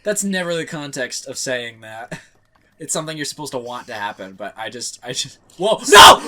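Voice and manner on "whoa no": sudden high shout. Real, not acted. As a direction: yell out in shock. Yells at sudden game death.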